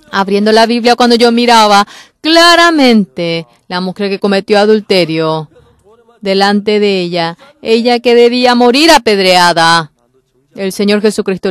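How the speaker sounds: loud, urgent pulpit proclamation; full-force preaching. Acted. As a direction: preach it out to the whole hall.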